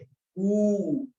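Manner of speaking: कू sustained high for three beats; extra-long vowel. Shown as a demonstration. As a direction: hold कू out like a crowing rooster. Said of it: "मुर्गा बोलता है". (कू को ऊँचा और बहुत लंबा खींचकर)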